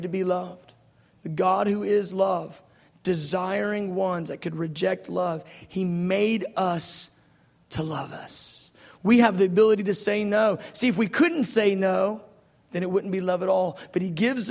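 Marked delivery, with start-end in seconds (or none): 5.7-8.7 slow and much softer, drawn out; tender, slow. Acted. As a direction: let it sink in gently. Sermon climax, slow and soft.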